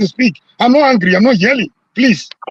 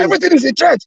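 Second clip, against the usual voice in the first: heated shouting; 5 semitones higher than usual. Real, not acted. Telling a fellow journalist everything is a threat, shouting. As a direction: yell the list at the moderator. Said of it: "why are you yelling?".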